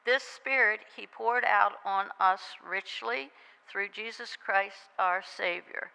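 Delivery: usual speaking voice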